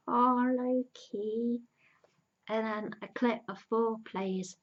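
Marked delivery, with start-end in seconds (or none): 0.1-1.6 tender sigh. Retold or imitated. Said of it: "and he's crying".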